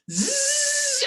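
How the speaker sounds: very high buzzing reel noise; reel whine. Retold or imitated. Imitating his fishing reel running out, high buzz. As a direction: imitate the line screaming off the reel. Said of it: "it's just singing".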